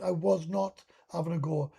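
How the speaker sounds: ordinary talk, nothing marked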